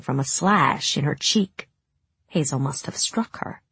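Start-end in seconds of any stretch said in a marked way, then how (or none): none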